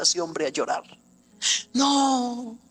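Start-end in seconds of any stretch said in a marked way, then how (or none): 1.7-2.6 tearful man. Retold or imitated. Man crying, quoted by preacher.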